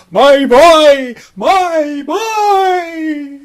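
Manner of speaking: put-on high, loud wailing my boy; wailing father. Father weeping over his son, loud high wail. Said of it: "and he was going".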